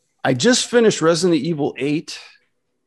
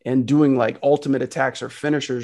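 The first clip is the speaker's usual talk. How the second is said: ordinary delivery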